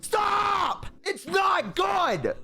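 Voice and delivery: high-pitched, drawn-out shout; comic high-pitched outburst; exasperated yelling. Acted. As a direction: yell it like pleading with family to stay away. Yelling at family watching stream.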